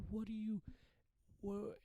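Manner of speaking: startled what. Retold or imitated. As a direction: squeak it out, baffled. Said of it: "I was like".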